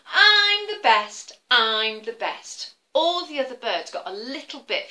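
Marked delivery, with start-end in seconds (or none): 0.1-2.7 chanting I'm the best, proud and slow; boastful eagle. Acted. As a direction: crow it out like a show-off eagle. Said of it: "What's he say?".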